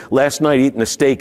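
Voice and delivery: ordinary talk, nothing marked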